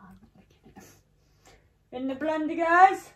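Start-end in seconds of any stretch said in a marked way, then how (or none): 0.1-0.8 whispering. Real, not acted. Whispered aside while filming.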